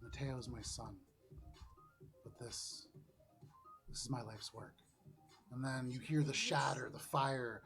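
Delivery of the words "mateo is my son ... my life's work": hushed, halting inner monologue; inner thoughts. Acted. Game master voicing Elise's overheard thoughts, hushed. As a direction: murmur it softly, as if thinking. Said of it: "listen to the thoughts of Elise Fukumoto".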